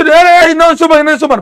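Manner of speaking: high, loud flustered student blurting out; mock flustered student. Teacher mocking a confused student's rushed answer, high and loud. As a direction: mimic a panicked student guessing wildly. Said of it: "अब ऐसे".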